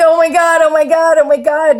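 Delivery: high, loud frantic oh my God; new stepmom panicking at two kids; panicked. Retold or imitated. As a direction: spiral into overwhelmed panic. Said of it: "terrifying fucking experience".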